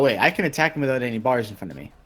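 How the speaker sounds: ordinary talk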